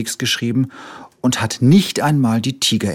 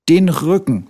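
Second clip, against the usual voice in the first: higher commanding cub voice; bossy little tiger; 7 semitones higher, 5 dB louder than usual. A narrator's reading. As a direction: order the doctor around. Tiger directs the bandaging.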